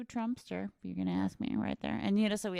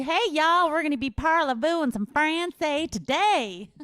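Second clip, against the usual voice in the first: put-on over-the-top Southern accent mangling French; 6 semitones higher, 7 dB louder than usual. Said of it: "So she".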